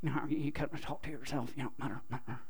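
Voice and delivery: soft mumble to yourself; quiet muttering. Shown as a demonstration. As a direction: murmur under your breath. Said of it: "The word literally means to mutter".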